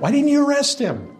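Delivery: the Pharisees scolding the officers, high and indignant; indignant demand. Acted. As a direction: fire it at them like an outraged official.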